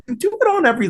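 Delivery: high, fast sing-song lilt; lilting mockery of a repetitive speaker; mock sing-song cadence. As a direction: parody the same tune on every sentence. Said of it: "I really like that you can do this intonation".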